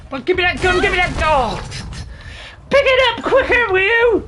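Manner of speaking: high, loud, urgent demands; frantic shouting. Real, not acted. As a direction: yell desperately for the gun. Player desperate to grab gun.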